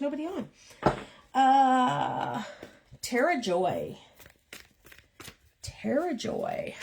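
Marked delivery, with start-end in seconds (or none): 5.6-6.7 slow, soft and higher; savoring the name. Shown as a demonstration. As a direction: linger on the name fondly. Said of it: "I like that two names".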